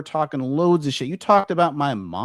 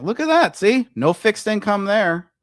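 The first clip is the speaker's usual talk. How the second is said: gloating; 8 semitones higher, 5 dB louder than usual. Real, not acted. Gloating over her bank account.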